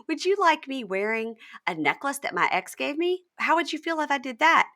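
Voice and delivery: high-pitched retold confrontation; imitated indignant challenge. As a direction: press the question, building in volume. Retelling his challenge to her.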